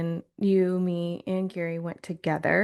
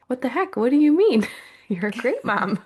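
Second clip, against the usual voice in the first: higher, laughing "what do you mean" burst out loud; mock disbelief; 7 semitones higher, 5 dB louder than usual. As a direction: play the mom's shocked laugh of disbelief. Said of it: "And you were like".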